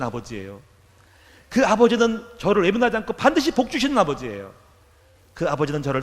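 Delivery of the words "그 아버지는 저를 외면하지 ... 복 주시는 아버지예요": ordinary talk, nothing marked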